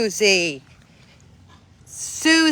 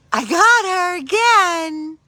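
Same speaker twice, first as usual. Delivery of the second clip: much higher, slow, with a gasp; excited gasp; 9 semitones higher, 5 dB louder, slower than usual. Real, not acted. Owner teasing Susie with her toy, excited playful gasp. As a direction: tease the dog with delight. Playing fetch with dog.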